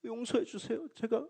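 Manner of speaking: a hint of a child's pure pleading; childlike prayer. Deacon repenting to God, lightly mimicked as a childlike plea. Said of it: "굉장히 어린아이처럼 순수한 마음으로".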